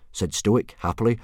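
ordinary delivery

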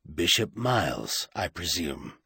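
low, cool and understated; an icy, deep-voiced greeting. A narrator's reading. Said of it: "when a deep, icy voice spoke".

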